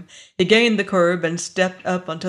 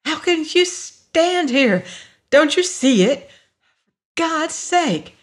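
high, drawn-out desperate plea; distraught, incredulous outburst; frantic appeal; 10 semitones higher, 4 dB louder than usual. A narrator's reading. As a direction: plead in horrified disbelief, voice pitched way up. Lois yanks free, frantic appeal.

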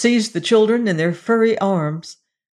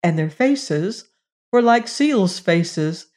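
plain reading voice

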